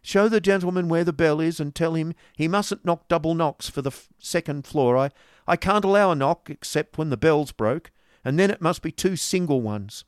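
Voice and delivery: loud high landlady calling down. A narrator's reading. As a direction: call down the stairs, bossy.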